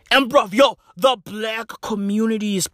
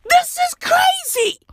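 much higher, incredulous; shocked. Retold or imitated. Narrator reacting in shock to the reveal. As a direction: sound stunned by it. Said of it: "I be like".

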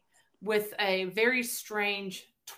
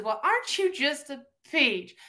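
raised-pitch mock-sweet Southern quote; sweetly insulting; 5 semitones higher than usual. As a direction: coo a veiled Southern insult. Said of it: "They said, "Aren't you just a bitch?".

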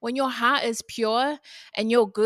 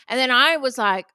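usual speaking voice

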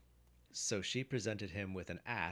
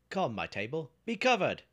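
loud command leaping up high; ringing, high-pitched magical command; shouted command; 9 semitones higher, 12 dB louder than usual. A narrator's reading. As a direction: order the magic table, building to a shout. Jack commands his magic table.

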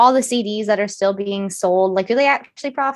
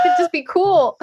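laughing; 3 dB louder than usual. Real, not acted. Laughing at own explanation.

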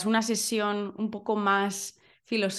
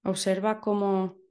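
guided meditation. Acted. Leads a breathing meditation.

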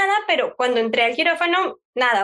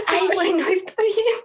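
meek agreement; 6 semitones higher, slower than usual. Retold or imitated. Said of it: "Y yo".

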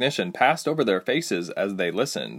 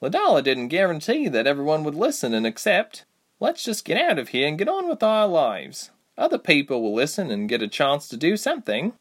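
consoling friend; 7 semitones higher than usual. A narrator's reading. Reshma consoles Ilse.

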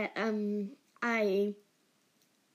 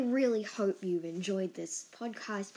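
usual speaking voice

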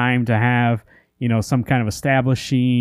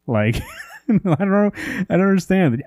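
higher voice with a real laugh; baffled laughing; 8 semitones higher than usual. Real, not acted. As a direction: laugh in bewilderment as you say it. Laughs in bafflement at plot hole.

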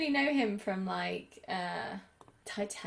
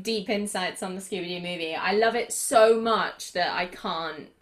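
ordinary talk

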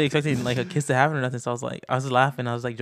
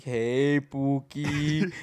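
imitated teasing greeting; much slower than usual. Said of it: "as he's typing, he's like".

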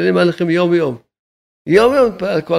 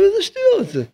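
high mocking voice. Rabbi scoffing at those arguing over two hundred shekels, high and mocking. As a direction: jump way up into a high, incredulous mocking pitch.

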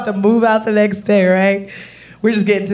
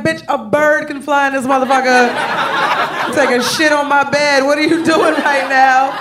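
exasperated rant; 6 semitones higher than usual. Retold or imitated. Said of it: "I was like".

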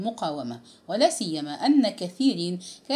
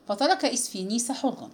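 usual speaking voice

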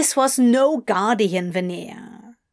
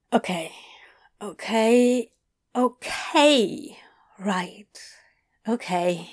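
hushed. Acted. Adam taking in Crowley's words, hushed. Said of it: "Adam whispered".

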